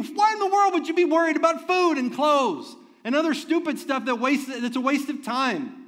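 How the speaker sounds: loud, high, driving sermon climax; preaching at full force. Real, not acted. Preacher rousing the congregation with a loud, high sermon climax.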